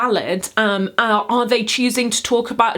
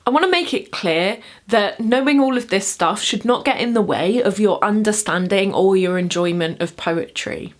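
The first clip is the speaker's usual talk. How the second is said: normal talking voice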